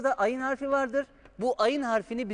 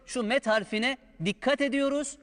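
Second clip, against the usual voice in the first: normal talking voice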